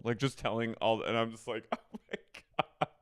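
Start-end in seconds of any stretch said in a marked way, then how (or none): 1.7-2.9 soft, high laughed gasp; laughing disbelief. Retold or imitated. Her stunned reaction, retold, laughing.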